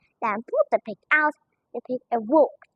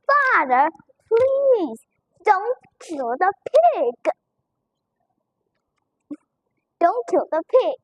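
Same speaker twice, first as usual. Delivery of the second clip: crying plea; 6 semitones higher, slower than usual. Acted. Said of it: "began to cry".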